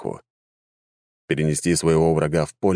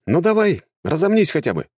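high-pitched indignant urging; 12 semitones higher than usual. Acted. Healer prodding the idle necromancer to fight, indignant. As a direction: protest with the voice pitched right up. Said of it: "возмутился я".

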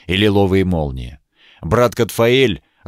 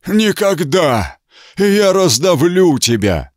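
monster roar; 9 semitones higher, slower than usual. Acted. Demonic bishop threatens the monk.

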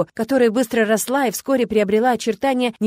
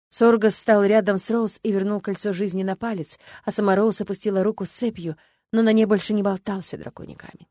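ordinary delivery

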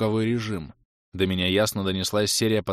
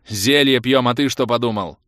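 loud, slightly raised, gruff comeback; grumbled retort; 3 semitones higher, 7 dB louder than usual. A narrator's reading. Mage answers the fighter's question, grumbling. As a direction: throw it back as a loud grumble. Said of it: "буркнул я".

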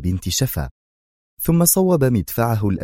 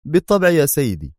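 higher, resigned of course; 8 semitones higher than usual. A narrator's reading. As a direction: give in to the boss. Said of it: "غمغم بيرت في استسلام".

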